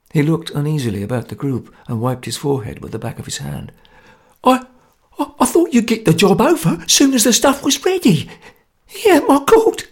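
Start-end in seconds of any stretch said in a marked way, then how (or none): 4.4-9.8 high, stammering squeak; frightened squeak. Acted. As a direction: squeak it out in fear. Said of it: "he squeaked under his breath".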